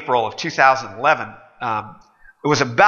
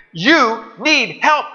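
slow, higher stern retort; stern waiter; 8 semitones higher, 3 dB louder, much slower than usual. Acted. Waiter telling the customer he needs help, slow and stern. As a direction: say it slow and pointed.

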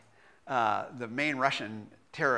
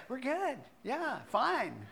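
fake fine; 9 semitones higher, 7 dB quieter, slower than usual. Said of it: "You're acting".

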